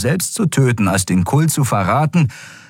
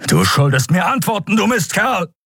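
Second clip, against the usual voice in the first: high angry yell; furious shout; 11 semitones higher than usual. Acted. Telios yelling furiously at the dead Draxyl. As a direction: yell it at the corpse.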